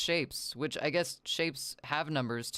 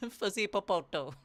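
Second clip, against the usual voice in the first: put-on cutesy baby talk; 7 semitones higher, 3 dB louder than usual.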